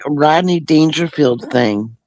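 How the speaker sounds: ordinary talk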